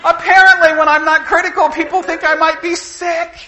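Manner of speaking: high, loud words broken by laughter; can't hold back the laugh; real laughter over the punchline; laughing while telling. Real, not acted. Laughing at the story.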